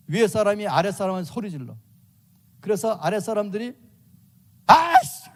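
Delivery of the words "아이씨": high, loud frustrated shout; an employee snapping and cursing loudly; angry outburst. Retold or imitated. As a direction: blurt it out in a fit of rage. Subordinate blowing up at boss.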